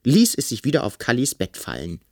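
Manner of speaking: usual speaking voice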